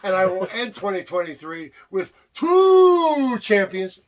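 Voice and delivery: slow, loud bragging declaration; boastful shout. Real, not acted. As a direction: proclaim it like a champion. Boasting about his champions.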